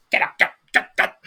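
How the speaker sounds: quick, voiceless shooing bursts. Acted. Gnome clearing people off the table, shooing. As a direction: shoo them off in short bursts. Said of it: "shoos people off".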